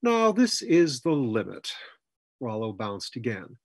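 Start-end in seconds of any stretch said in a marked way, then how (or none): 0.0-1.7 higher-pitched, put-upon drawl. A narrator's reading. A voice from behind objects to them, drawling. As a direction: draw it out, fed up. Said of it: "a voice from behind them drawled".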